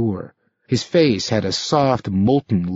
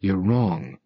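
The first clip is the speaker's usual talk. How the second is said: grim mutter; 4 semitones lower, 5 dB quieter than usual. A narrator's reading. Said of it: "Rick muttered grimly".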